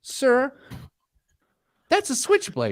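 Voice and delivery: high-pitched, mock-scandalized objection; taken-aback retold protest. Telling the salesman it's a switchblade, mock-alarmed.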